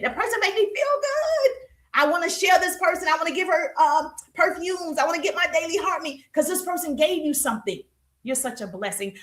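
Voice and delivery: high, excited fan voice gushing about a seller; grateful follower. Retold or imitated. Coach acting a happy follower who wants to share, high gushing voice.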